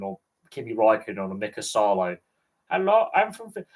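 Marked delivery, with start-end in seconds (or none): 2.7-3.7 mock Finnish accent, pitched up; imitating a Finnish woman speaking English; lightly hammed-up Finnish accent. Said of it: "her Finnish accent was so funny".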